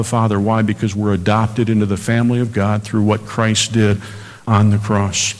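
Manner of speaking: normal talking voice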